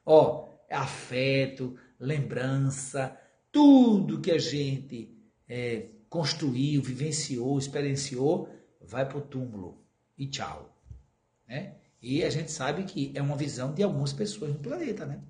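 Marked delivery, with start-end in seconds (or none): 0.0-10.7 slow, drawn out. Real, not acted. Drawn-out listing.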